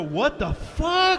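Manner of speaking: high, drawn-out disbelief; shocked onlookers. Acted. People in cars seeing the plane, stunned high cry. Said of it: "saw people in their cars go".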